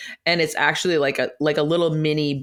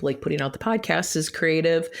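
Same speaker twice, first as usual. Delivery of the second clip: ordinary delivery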